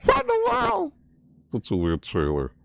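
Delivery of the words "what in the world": very high screech; shocked shriek. Real, not acted. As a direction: screech it in disbelief. Shrieking at trailer.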